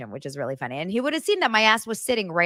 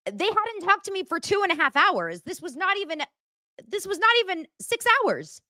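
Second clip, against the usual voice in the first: high-pitched mock indignation; mock outrage; 11 semitones higher than usual. Host mocking her worried co-hosts, high mock outrage. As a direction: squeal it in pretend outrage.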